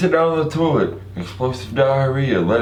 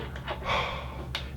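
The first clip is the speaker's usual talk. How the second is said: weary sigh; 16 dB quieter than usual. Retold or imitated. You desperate for relief.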